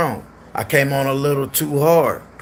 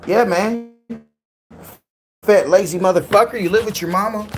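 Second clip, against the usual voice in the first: higher, lightly voiced taunting Scar; mocking Scar; 7 semitones higher than usual. Repeating what Scar said, in a lightly raised taunting voice. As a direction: toss off the insult like a smug heckler. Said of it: "I said".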